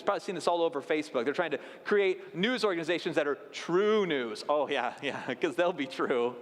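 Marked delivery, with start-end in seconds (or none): 4.5-6.4 low, dry sarcastic agreement. Real, not acted. Preacher doubting so-called true news organizations, sarcastic. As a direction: scoff with mock agreement, pitched low.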